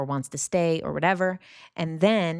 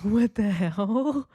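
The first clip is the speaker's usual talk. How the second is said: slow, higher, startled exclamation; 5 semitones higher, 4 dB louder, much slower than usual. Retold or imitated. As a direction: caught off guard by a cat on the bed. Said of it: "and I'm like".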